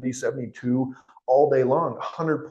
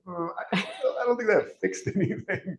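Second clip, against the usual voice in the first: laughing irony; 8 semitones higher than usual. Retold or imitated. Patients realizing diet failed.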